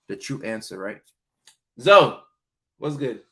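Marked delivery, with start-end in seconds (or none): 1.8-3.2 a loud, higher call of the name; a loud greeting shout to the viewer Zoe. Real, not acted. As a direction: call her name across the room. Calling to a viewer.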